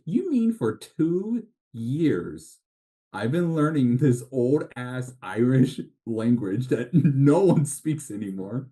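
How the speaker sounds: drawn-out, high-pitched disbelief, tipping into laughter; mock incredulous. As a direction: retell your dismay, stretched out and half laughing. Retold dismay at learning archaic Irish.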